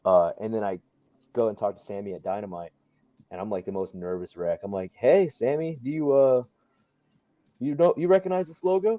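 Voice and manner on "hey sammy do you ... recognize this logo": high, loud, easygoing question; smooth casual ask. Retold or imitated. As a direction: act cool and confident asking. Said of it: "I didn't say it that cool".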